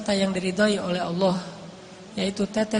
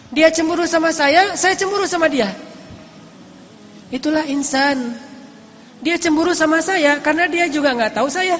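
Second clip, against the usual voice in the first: higher, emphatic preacher's voice; raised, insistent sermon delivery; impassioned preaching; 5 semitones higher, 4 dB louder than usual. Acted. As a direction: preach it out with rising conviction.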